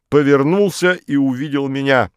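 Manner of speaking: ordinary delivery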